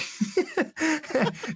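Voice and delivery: high burst of laughter; laughing. Real, not acted. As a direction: laugh at your own failure. Laughs at not getting the book.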